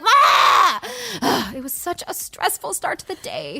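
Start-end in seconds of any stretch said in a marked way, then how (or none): 0.0-1.5 short mock scream; retold scream. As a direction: let out an exaggerated yell of stress. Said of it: "I was like".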